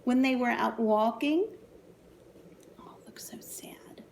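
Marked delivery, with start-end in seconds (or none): 2.8-4.0 soft sympathetic whisper. Real, not acted. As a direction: hush it like a secret. Aside to children.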